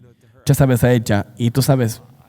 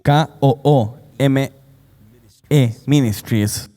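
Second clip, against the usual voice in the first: slow spelling; slower than usual. Shown as a demonstration. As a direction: spell out K O O M E one letter at a time, slowly. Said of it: "se deletrea". (deletreo lento, letra por letra)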